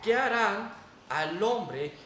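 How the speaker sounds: usual speaking voice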